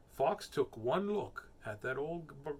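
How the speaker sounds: plain reading voice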